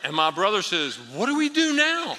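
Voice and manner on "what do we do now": high, loud bewildered cry; mock panic. Rattled brother to preacher outside the meeting, mock panic. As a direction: throw up a loud, helpless question.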